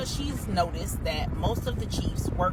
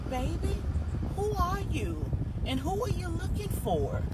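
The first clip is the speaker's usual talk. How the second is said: put-on high, soft, confused old lady; 11 semitones higher, 3 dB quieter than usual. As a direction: play the sweet, muddled granny who knows nothing.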